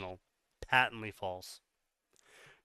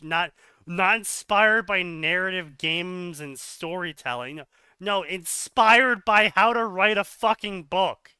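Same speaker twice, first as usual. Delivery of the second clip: louder and higher, building to a shout; exasperated rant; 5 semitones higher, 8 dB louder than usual. Real, not acted. Critic correcting a writer's boast, exasperated loud rant.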